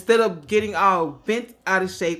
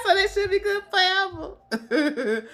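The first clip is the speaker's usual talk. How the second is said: laughing; 12 semitones higher than usual. Real, not acted. Joke about forever stamps.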